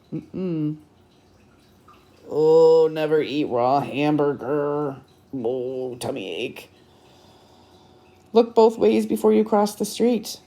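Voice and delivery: plain reading voice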